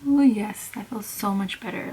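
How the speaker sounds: ordinary delivery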